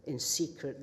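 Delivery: usual speaking voice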